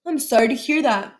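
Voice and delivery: ordinary delivery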